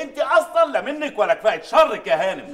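loud, high furious demand; angry shouting. Acted. Adel shouts at the maid and his mother for his eggs with pastirma, furious. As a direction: yell it like a spoiled man who wants his eggs.

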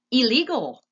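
high, loud shouted retort; loud one-word comeback; shouting back. A narrator's reading. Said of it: "shouted Meg back".

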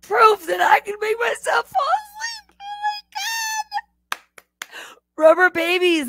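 words squeezed out through real laughter; laughing. Real, not acted. Watching her own sleeping clip with viewers, real laughing. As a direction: laugh helplessly while talking. Laughing at herself asleep on stream.

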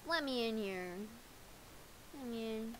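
ordinary talk

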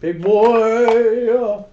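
loud call. Real, not acted. Friend opening cards, calls out card name loudly. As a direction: blurt it out high and loud.

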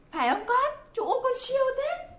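very high-pitched delighted cheer; gushing praise. Pretend. Teacher praising a pupil for spelling, excited high-pitched praise. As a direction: praise a small child in a high, amazed voice.

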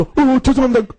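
high-pitched mock stammered apology; retold fluster, voice jumping up. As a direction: act out an embarrassed, startled apology. Retold apology to startled strangers.

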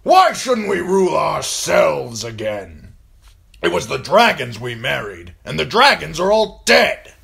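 fiery booming tirade; blustering warlord. Acted. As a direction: bellow your contempt to the whole hall. Great John rouses the hall.

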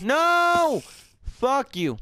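high panicked shriek; scream. Real, not acted. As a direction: cry out in alarm. Dog being attacked.

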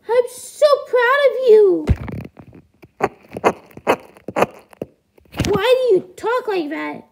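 high falsetto, proud of a grown son; high-pitched puppet parent; duckling's parent, won over. Acted. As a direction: gush in a squeaky high parent voice.

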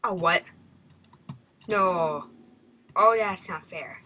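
loud, falling groan of disbelief; dismayed protest. Real, not acted. Player set back by the game, dismayed protest. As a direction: protest loudly at the unfairness.

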